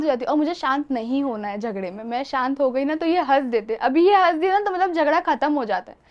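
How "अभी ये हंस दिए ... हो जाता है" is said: usual speaking voice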